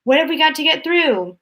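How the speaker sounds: high, loud worried question; a touch of panic; alarmed old man. A narrator's reading. Grandpa Joe alarmed.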